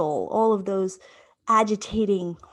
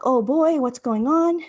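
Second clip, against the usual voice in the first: retold anxiety; 6 semitones higher, 6 dB louder than usual. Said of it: "And I'm like".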